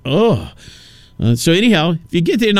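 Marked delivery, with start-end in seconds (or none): 0.1-0.5 acted-out revolted "ugh"; short groan of disgust. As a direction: groan like you've just been grossed out. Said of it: "I left there like, just like".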